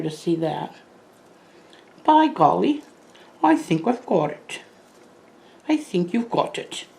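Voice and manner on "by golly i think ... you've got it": put-on a little bit of a British butler accent, pitched higher; playfully imitating the movie butler's British accent. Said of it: "I said it with a little bit of the accent".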